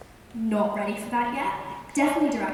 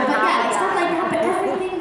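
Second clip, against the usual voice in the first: ordinary talk, nothing marked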